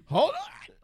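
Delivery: laughing mock overwhelm. Acting overwhelmed reaction.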